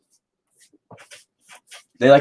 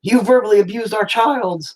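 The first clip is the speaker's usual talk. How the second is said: much higher indignant parent; mock indignant parent; 12 semitones higher than usual. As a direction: accuse him like an outraged mom. Imitating angry parent.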